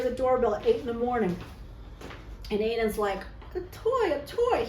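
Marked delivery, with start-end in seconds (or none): 3.5-4.7 put-on high, eager child's cry; light imitation of her son; kid excited. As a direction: call it out like a little boy at the window. Child voiced in story.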